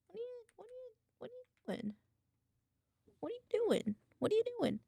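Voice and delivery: high cooing pet voice. Real, not acted. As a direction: coo at the cat. Baby talk to cat.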